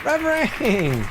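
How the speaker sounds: high, bright cry of delight; sudden happy exclamation; excited shout. Real, not acted. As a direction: call it out thrilled. Cheering a game item.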